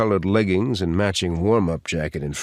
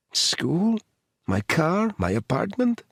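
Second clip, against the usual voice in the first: higher, worried Eastern European woman; anxious Eastern European accent; 7 semitones higher, slower than usual. A narrator's reading. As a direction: list her fears anxiously in a light Eastern European accent. Edita listing fears.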